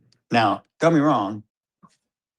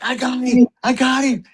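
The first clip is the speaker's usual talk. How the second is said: excited; 13 semitones higher, 4 dB louder than usual. Retold or imitated. Son excited after the kill.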